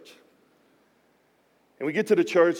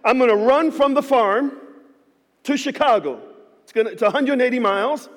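higher, louder, pumped-up call; excited announcement; 5 semitones higher, 5 dB louder than usual. Retold or imitated. Pastor announcing his run to the church, excited and loud. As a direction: fire up the crowd. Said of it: "So I get excited".

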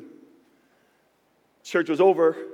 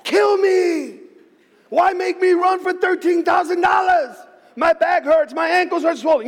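much higher, loud shouting complaint to God; anguished shout; 11 semitones higher, 6 dB louder than usual. Retold or imitated. Pastor shouting his complaint to God in his office, anguished. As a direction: cry out in exasperation. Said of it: "have a discourse with my father".

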